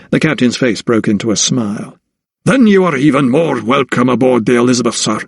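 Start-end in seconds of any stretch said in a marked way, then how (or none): 2.4-5.2 friendly Scottish brogue of a sea captain; affable Scottish brogue. Acted. Captain welcoming the doctor aboard, affable Scottish brogue. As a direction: roll it out in a genial Scottish accent. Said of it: "in an affable Scottish brogue".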